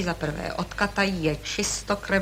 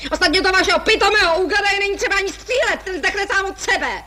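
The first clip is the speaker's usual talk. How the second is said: high, loud, fast contempt; hurried high scornful jab; spiteful taunt; 10 semitones higher, 3 dB louder than usual. Acted. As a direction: rattle off the insult at a high pitch.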